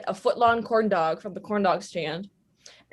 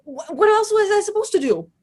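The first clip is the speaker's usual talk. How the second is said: high, incredulous defense of grabbing the Bible; incredulous high protest; 12 semitones higher, 5 dB louder than usual. Retold or imitated. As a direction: voice jumps up high in mock disbelief. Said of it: "I was like".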